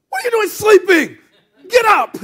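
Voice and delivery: very high, loud acted shout; indignant yelling. Disciple rousing sleeping Jesus in the storm, indignant yell. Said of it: "somebody's yelling this".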